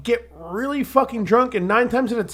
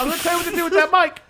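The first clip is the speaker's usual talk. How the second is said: high, rapid mock scolding; mock bossing; 8 semitones higher, much faster than usual. Co-host squabbles over the mic, mock bossing. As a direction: rattle it off high, overriding your friend.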